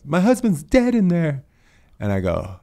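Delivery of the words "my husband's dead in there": high, louder anguished mock of the widow; distraught widow. Retelling the neighbour's words about her husband, high and anguished. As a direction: voice her shock, pitched up high. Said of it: "And she goes".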